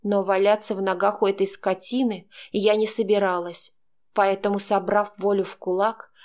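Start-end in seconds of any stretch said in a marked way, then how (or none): none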